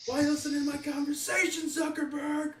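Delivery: high-pitched, hammed-up yell; play-acted outburst, loud and very high; mock angry shout. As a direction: shout an outraged confrontation for laughs. Acting out confronting Zuckerberg in a fight.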